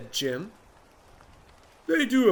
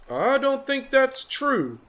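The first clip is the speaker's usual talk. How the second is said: higher, slow doubtful voice; Water Buffalo; 10 semitones higher, 3 dB louder, slower than usual. Acted. Water Buffalo doubting from beneath the tree. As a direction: say it slowly, unconvinced.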